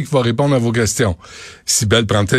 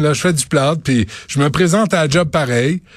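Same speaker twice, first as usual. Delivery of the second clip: normal talking voice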